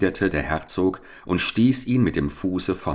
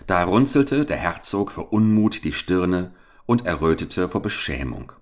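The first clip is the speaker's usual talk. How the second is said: plain reading voice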